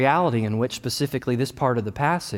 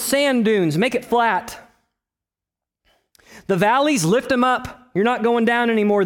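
preaching; 8 semitones higher than usual. Acted. Preacher urging the congregation, high and fervent. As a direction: call the people to get ready.

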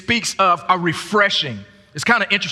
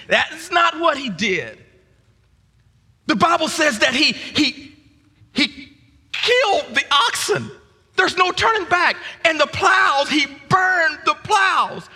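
preaching fervor; 10 semitones higher than usual. Acted. Preacher building to a climax about Elisha burning the plows, fervent and high. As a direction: drive it home like a revival preacher.

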